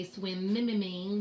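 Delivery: "swimmimming" with the m repeated in extra syllables; wrong doubled sound. Shown as a demonstration. Teacher showing how not to say swimming, m sound repeated. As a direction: stutter the m in "swimmimming", mim-mim-ing. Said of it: "we don't say".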